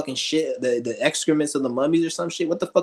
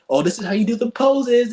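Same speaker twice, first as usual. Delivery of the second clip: high, louder mock of a creepy instructor; creepy teacher impression; 8 semitones higher, 5 dB louder than usual. Mocking a groomer teaching a girl poses, higher and louder. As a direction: lay it on as a sleazy coach.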